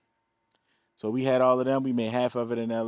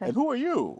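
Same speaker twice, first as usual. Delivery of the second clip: eccentric European man; 13 semitones higher than usual. Retold or imitated. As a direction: stretch it out high and grand, like an odd old European. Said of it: "That's how he talks".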